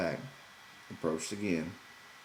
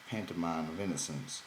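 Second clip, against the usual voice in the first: ordinary delivery, nothing marked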